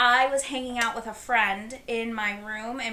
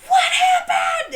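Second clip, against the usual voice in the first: shout; 10 dB louder, slower than usual. Retold or imitated. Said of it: "She's like".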